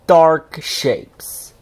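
plain reading voice

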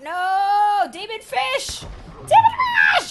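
high, loud screaming at the demon fish; panicked high shriek; terrified scream. Real, not acted. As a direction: scream as the monster bites. Attacked by fish.

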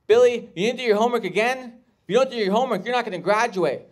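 Disney Channel teacher scolding Billy. Acted. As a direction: scold a student in the front row. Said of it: "Teacher talking to her student".

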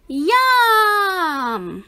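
loud high yum; delighted cheer. A narrator's reading. The class getting milk, loud happy yum. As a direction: relish it.

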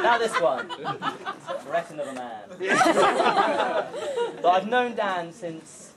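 normal talking voice

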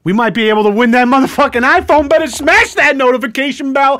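high, loud hyped-up pitch; hype YouTuber. Pretend. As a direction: sell it like an overhyped YouTuber. Hype parody of giveaway.

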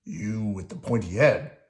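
loud, rough grouch call; gruff puppet grouch; Oscar. A narrator's reading. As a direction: heckle a passer-by loudly. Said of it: "Oscar called as Bert passed".

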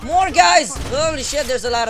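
high, loud warning shout; alarmed callout. Real, not acted. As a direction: yell it in sudden alarm.

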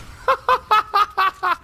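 high rapid giggling cackle; real high-pitched laughter; gleeful laugh. Real, not acted. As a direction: keep laughing at your own trick. Delighted by own trick.

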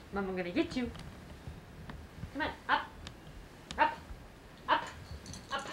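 ordinary delivery

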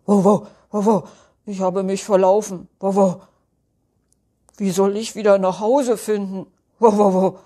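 loud woofs around hurried confessions; lost talking dog, a touch sheepish; bark, then own up quickly; embarrassed dog. Acted. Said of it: "Oskar antwortet verlegen".

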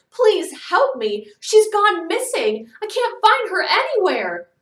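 high, loud hysterical mother; desperate, panicked shout; frantic cry. A narrator's reading. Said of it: "Missus Brahms cried frantically".

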